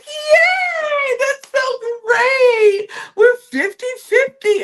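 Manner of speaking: exaggerated celebration, high and stretched out; mock cheering. Mocking Baldasha's celebration in retelling.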